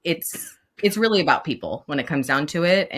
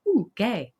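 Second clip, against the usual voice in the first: higher, delighted little squeal of recognition; 6 semitones higher than usual. Retold or imitated. Queer teacher spotting pride flags, chirpy and high. Noticing rainbows, playful exclaim.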